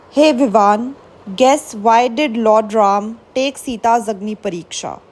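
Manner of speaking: plain reading voice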